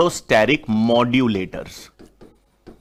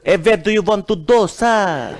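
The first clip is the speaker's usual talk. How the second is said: slow, lisping mock-European English; exaggerated slow foreign cabbie voice; mock Russian cabbie; 5 semitones higher, much slower than usual. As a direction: imitate a cab driver who can't say hard T. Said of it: "तोतले होते हैं".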